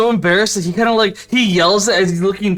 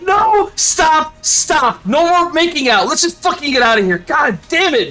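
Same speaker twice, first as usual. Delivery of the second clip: exasperated yelling; 9 semitones higher than usual. Acted. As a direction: order them to stop, exasperated. Ash yells at kissing couple.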